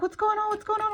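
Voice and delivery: high, loud, flustered question; alarmed grandmother voiced high and loud. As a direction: play a startled grandma rushing over.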